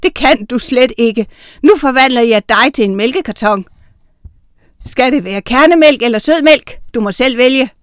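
loud, mocking villainess; taunting witch. A narrator's reading. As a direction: toy wickedly with your victim. Witch taunts her attacker.

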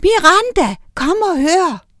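shouted singsong summons; calling from below. A narrator's reading. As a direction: call out urgently to someone upstairs. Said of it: "Med et råbte Oscar ned fra kælderen".

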